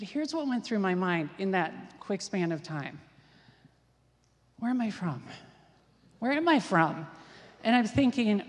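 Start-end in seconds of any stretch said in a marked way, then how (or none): 4.6-5.2 inner question with a touch of alarm, falling at the end; mock-worried first asking; mild retold panic. What went through the teller's mind, mild mock panic. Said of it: "I was in such a panic". 6.2-7.1 same inner question hit louder, mock alarm; re-enacted panic mounting, punched out; louder retold panic. Teller's mind racing at the border, louder mock panic. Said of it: "I was in such a panic".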